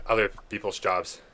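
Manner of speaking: usual speaking voice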